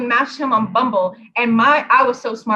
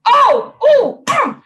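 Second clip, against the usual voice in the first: sudden exclamation; 13 semitones higher, 6 dB louder than usual. Real, not acted. Sudden outburst about the guy.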